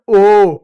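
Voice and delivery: ओ called out loud and clear; teacher calling out the vowel; loud vowel demo. Shown as a demonstration. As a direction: belt out ओ for the class to repeat. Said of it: "किसका प्रयोग करते हैं?".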